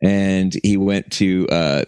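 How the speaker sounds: ordinary talk, nothing marked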